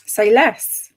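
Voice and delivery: thrilled reaction; excited. Real, not acted. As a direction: pounce on it with glee. Reacting to favourite trope.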